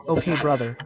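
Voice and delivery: ordinary delivery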